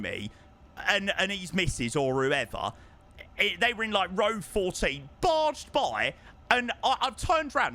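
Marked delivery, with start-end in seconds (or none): none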